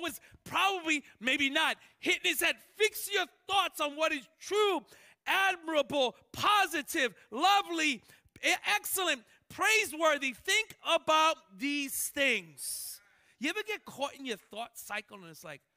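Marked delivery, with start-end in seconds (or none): 2.8-13.0 loud, higher scripture list; emphatic reading. Real, not acted. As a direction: drive each word home.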